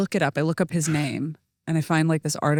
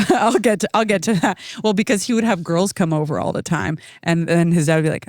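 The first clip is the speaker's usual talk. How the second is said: ordinary talk, nothing marked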